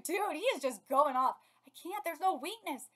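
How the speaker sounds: usual speaking voice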